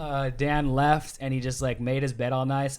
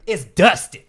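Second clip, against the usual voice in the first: high, very loud mock revelation; dramatic reveal; 6 semitones higher, 12 dB louder, slower than usual. [name] naming his lover to Jackson, dramatic reveal. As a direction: blurt the name out loud, near laughing.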